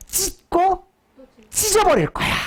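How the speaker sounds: high, emphatic voicing of God; high emphatic threat. Shown as a demonstration. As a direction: cry out 찢고 찢어버릴 거야 high as God's threat. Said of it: "다른 말 이런 말이야".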